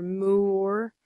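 "Mower" drawn out slowly as MOO-er; cow-pun pronunciation. Shown as a demonstration. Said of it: "don't know how to say it".

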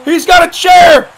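high, loud, slow stretched call; alarmed wrestling call; excited ringside shout. Real, not acted. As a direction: shout the news to the crowd. Calls a weapon in the match.

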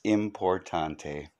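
importante said French style, soft and slow; a quiet, slow French importante; French accent. Shown as a demonstration. Joking host says importante like a Frenchman. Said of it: "Or as they say in France".

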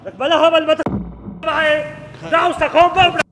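loud furious yelling at him; angry shout. Acted. Quarrel with driver over car.

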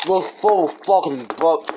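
talking with liquid in mouth; mouth full. Real, not acted. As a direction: lips puffed, after drinking. Seen 1: Bowl drunk from at -5 to -4; during words lips puffed, mouth full.

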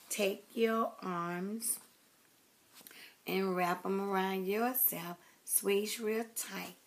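slow, drawn-out words with pauses; slow guided hug. Real, not acted. Vlogger sending viewers a hug to give themselves, very slowly. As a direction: stretch out each instruction, unhurried.

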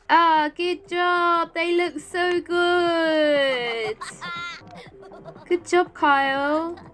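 slow, delighted cooing praise; gushing praise. Real, not acted. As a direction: gush over their work, stretching each word. Praising game characters' decorating.

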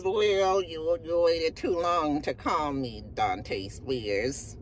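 lightly put-on New York accent, low and slow; a hint of a tough New York detective; fake New York accent. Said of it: "With her fake ass New York accent".

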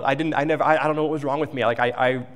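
ordinary talk, nothing marked

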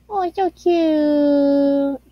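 put-on very slow, drawn-out baby-voice coo; cutesy coo. Cooing at adorable fan art, slow drawn-out baby voice. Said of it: "Ladle, why are you talking like this?".

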